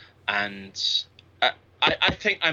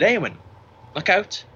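warning call; 6 semitones higher, 4 dB louder than usual. Retold or imitated. Calling a warning to daughter.

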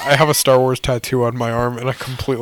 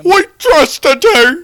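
high, loud, drawn-out Cockney wail; hammed-up weeping old British man; Michael Caine; 7 dB louder, slower than usual. Butler lamenting broken trust, mock-anguished Cockney impression. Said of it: "You gross old British man".

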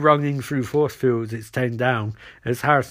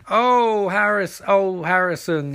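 higher, drawn-out "oh"s in a mild imitation; lightly voiced Caitlin; 6 semitones higher, slower than usual. Caitlin exclaiming to Harrison, lightly imitated high drawl. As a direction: stretch it out high, lightly acted.

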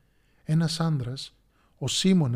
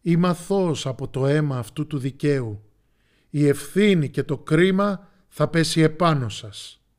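loud, solemn proclamation; public declaration; 8 dB louder than usual. A narrator's reading. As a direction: announce your innocence to the crowd. Pilate washes hands, declares to crowd.

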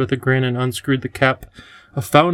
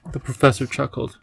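ordinary delivery

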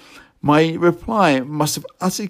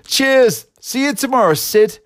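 bright high call-out, much higher and louder; cheery call; 11 semitones higher, 4 dB louder than usual. Retold or imitated. Calling cheerily after a grumpy workmate. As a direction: call out cheerily across the depot. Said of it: "I called out with unabated cheeriness".

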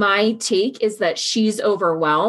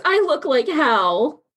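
high, slow, whiny self-pity; self-pitying whine; 7 semitones higher than usual.

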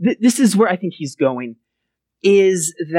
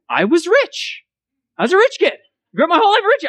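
emphatic; 12 semitones higher than usual. Real, not acted. Emphatic self-mocking recollection.

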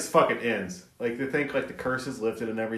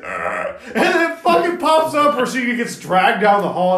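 usual speaking voice

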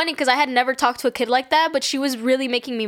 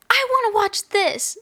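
high-pitched imitation of a bratty child; demanding kid; 9 semitones higher than usual. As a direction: mimic a kid insisting on her way. Acting a demanding little kid.

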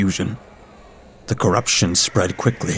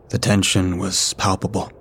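ordinary delivery

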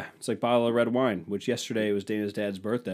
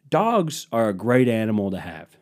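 normal talking voice

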